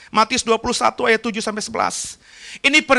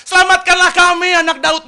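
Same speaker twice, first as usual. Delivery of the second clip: crowd plea; 8 semitones higher, 5 dB louder than usual. Retold or imitated. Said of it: "mereka bilang".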